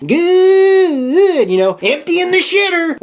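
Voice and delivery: high, loud goofy movie-dad voice, half crying; Clark Griswold impression. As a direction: squeal it like a giddy, teary dad. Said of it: "I'm thinking, Clark Griswold".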